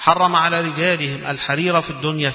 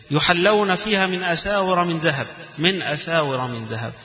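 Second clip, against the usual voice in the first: higher melodic recitation, starting strong on يحلون; Quran recitation; 4 semitones higher than usual. Acted. Teacher recites the verse aloud to his class, chanted.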